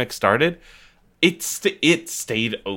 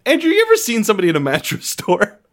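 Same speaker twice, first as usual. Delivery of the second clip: laughing while talking, pitch high; 11 semitones higher than usual. Real, not acted. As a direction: giggle through the words. Laughing through a mattress joke.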